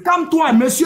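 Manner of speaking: shouting over the other man; heated shout. Real, not acted. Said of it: "arrête de crier là".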